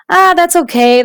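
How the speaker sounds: cheerful smiling shrug. A narrator's reading. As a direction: brush it off with a smile. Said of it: "the customer said with a smile".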